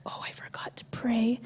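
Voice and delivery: breathy whisper of sudden panic; whispered thought. Retold or imitated. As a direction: hush it like a secret realization. Sudden realization mid conversation.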